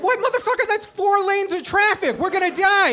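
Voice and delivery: very high, frantic passenger shriek; acted terror, voice shot way up; loud, hammed-up panic; panicked protest. Passenger panics at four lanes.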